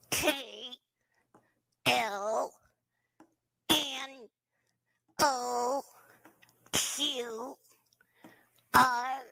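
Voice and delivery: soft nasal letters, lips still; ventriloquist voice. Shown as a demonstration. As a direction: say each letter without moving lips. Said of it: "Listen and repeat without moving your lips".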